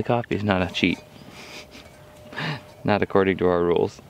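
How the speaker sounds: usual speaking voice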